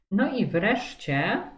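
ordinary talk, nothing marked

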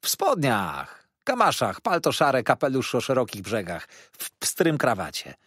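waiter's emphatic description; insistent waiter. A narrator's reading. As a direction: prompt the guest's memory item by item.

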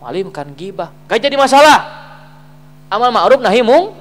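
loud, high-pitched sermon delivery; forceful preaching. Real, not acted. Preacher warns the congregation about unreported wrongdoing, forceful preaching.

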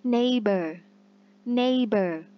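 Neighbor đọc rõ kiểu tiếng Anh; English word model. Shown as a demonstration. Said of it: "teacher models word twice". (đọc mẫu từ Neighbor)

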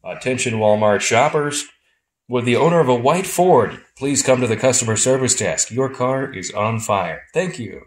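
store intercom announcement over the phone; flat echoey pa page. Pretend. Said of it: "I can do a, a, uh, page announcement".

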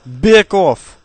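shout. Real, not acted. Fending off zombies in game.

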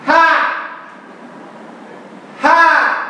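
high punchy Ha!; hammed-up TV host shout; Sérgio Malandro yell. Shown as a demonstration. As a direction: bark the catchphrase like a TV host. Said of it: "Esse é o Sérgio Malandro".

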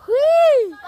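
high gleeful squeal; excited whee. Real, not acted. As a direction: squeal with joy on the swing. Swing ride exclamation.

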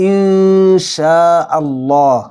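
ইনশাআল্লাহ with a hidden nasal n, slowly; correct ikhfa. Shown as a demonstration. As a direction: hum the n in ইনশাআল্লাহ, no ng. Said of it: "এটা ঠিক আছে". (ইনশাআল্লাহ সঠিক ইখফা দিয়ে)

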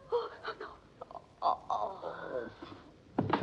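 breathless whispered horror, fading; horrified whisper. Acted. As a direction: whisper it as you faint. Wife faints at empty morgue slab.

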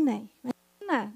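normal talking voice

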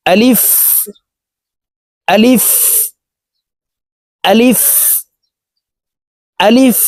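a short rising আলিফ for students to copy; quick alif repeated. Shown as a demonstration. Teacher has students repeat the quick আলিফ. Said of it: "আমার সঙ্গে বলার জন্য চেষ্টা করুন".

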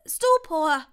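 high, very loud spell cry; called-out spell. A narrator's reading. Longbottom casting at the spider, crying out. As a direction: shout the incantation high. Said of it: "rief Longbottom".

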